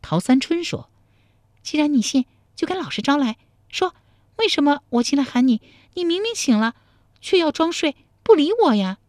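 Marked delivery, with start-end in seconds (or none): 1.6-9.0 high-pitched cross heroine; high, indignant woman's questioning; feisty young woman, demanding. Acted. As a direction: press him for a confession in a high voice. Feisty woman character questions him.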